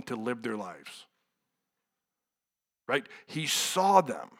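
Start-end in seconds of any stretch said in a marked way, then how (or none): none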